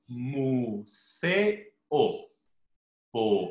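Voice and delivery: "museo, poema" split into slow, separate syllables; sílaba por sílaba. Shown as a demonstration. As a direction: break "museo" and "poema" at every syllable, pausing between vowels. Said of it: "se pronuncian en sílabas distintas". ("museo, poema" separando cada sílaba con pausas)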